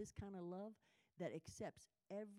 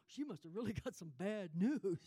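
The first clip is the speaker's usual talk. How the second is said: louder mock bystander voice with a laugh; imitated onlooker; 8 dB louder than usual. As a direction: voice a nosy passerby, amused.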